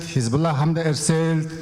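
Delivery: usual speaking voice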